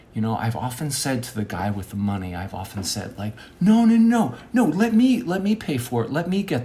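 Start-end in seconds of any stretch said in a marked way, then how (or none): 3.6-6.6 high, loud string of refusals; insistent protest. Acted. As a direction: jump in urgently to grab the bill. Acted protest over paying the check.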